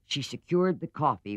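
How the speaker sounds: usual speaking voice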